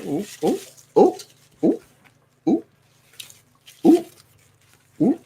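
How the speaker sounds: high delighted oohs; excited cooing. Real, not acted. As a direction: coo over a new treasure. Delighted over the deck.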